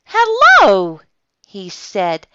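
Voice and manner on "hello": very high, loud, drawn-out hello; watchman's greeting. A narrator's reading. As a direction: sing out a big surprised hello. Watchman finds the bear.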